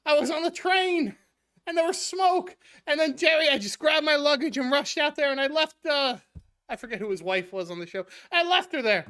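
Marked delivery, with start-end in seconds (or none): 0.1-6.2 higher, louder frantic retelling; George panicking. Retold or imitated. George telling Jerry he fled the train, higher and louder. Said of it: "and George goes". 8.3-9.0 higher, louder frantic retelling; George panicking. Retold or imitated. George telling Jerry he fled the train, higher and louder. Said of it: "and George goes".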